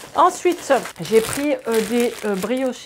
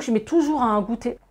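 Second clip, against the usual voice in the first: ordinary talk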